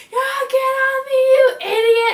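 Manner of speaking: high, loud, slow furious shout; retold angry yell. Pinned girl yells at the driver on her arm, furious high shout. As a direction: bellow it at the boy on your arm. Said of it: "calling him an idiot".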